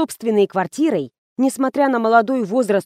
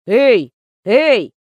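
loud, short hail; louder, insistent call; 5 dB louder than usual. A narrator's reading. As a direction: call to get someone's attention. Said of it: "окликнул её Кирсавин".

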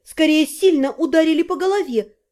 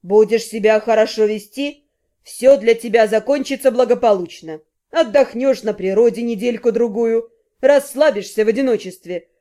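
menacing kidnapper; 3 semitones lower than usual. A narrator's reading. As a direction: threaten calmly with a sneer. Kidnapper lectures captive.

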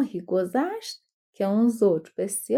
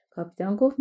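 ordinary delivery

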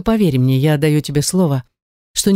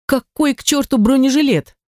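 high, indignant outburst; outraged; 9 semitones higher than usual. A narrator's reading. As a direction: fire back in disbelief. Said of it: "опешил я и оттолкнул его".

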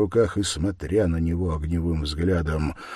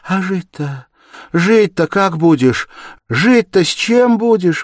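high woman's voice, building loud; distraught exclamation; 13 semitones higher, 11 dB louder than usual. A narrator's reading. Sonya asking how he will live, distraught. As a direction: cry out, each question more urgent. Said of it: "восклицала Соня".

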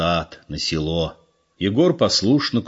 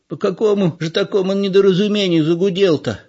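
high, blunt granny's question; blunt old woman; 12 semitones higher, 4 dB louder than usual. A narrator's reading. Old mother questioning the convict, high and blunt. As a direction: ask straight out like a nosy old woman. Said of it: "прямо спросила старуха".

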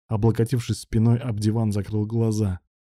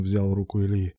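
ordinary delivery

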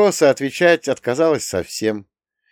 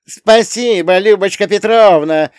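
high, loud mocking imitation; mocking mimicry; 9 semitones higher, 8 dB louder than usual. A narrator's reading. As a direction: mimic her sneeringly. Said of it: "передразнила она".

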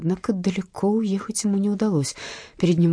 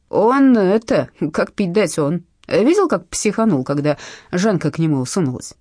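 loud nasal insisting; nasal thug; 5 dB louder than usual. Acted. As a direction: push it through the nose, loud. (гнусавый громкий бандит)

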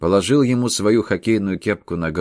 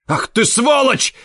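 high loud slow roar; furious shout; 5 dB louder, slower than usual. A narrator's reading. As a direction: yell it in rage. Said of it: "закричал Дятлов".

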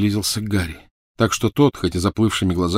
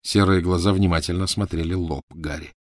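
ordinary delivery, nothing marked

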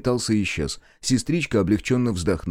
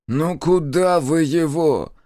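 higher, louder, slow indignant protest; indignant doctor; 8 semitones higher, 4 dB louder, slower than usual. A narrator's reading. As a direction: object to the wounded being dumped there. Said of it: "возмутился местный хозяин".

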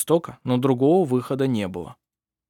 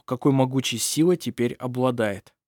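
plain reading voice

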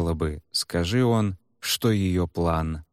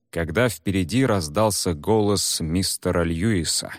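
usual speaking voice

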